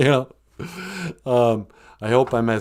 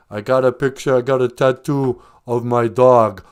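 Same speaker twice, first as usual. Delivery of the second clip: normal talking voice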